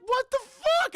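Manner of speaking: very high, loud squawk of shock; hammed-up outraged astonishment; mock disbelief. Bystander reacts to kid's insult, high mock disbelief.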